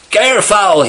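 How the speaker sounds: loud, high alarmed shout; scream. Acted. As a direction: scream out a warning. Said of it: "he screamed".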